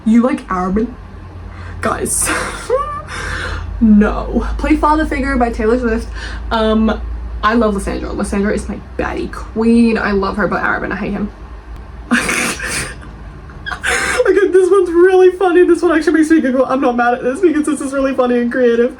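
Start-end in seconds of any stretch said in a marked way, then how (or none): 2.3-3.8 high, teary laugh; helpless laughter. Real, not acted. Reacting to a review liking Arobin. 11.4-14.2 silent laughter. Real, not acted. Reacting to a funny review. 14.3-19.0 high, loud words through laughter; laughing while talking. Real, not acted. Amused by a funny review.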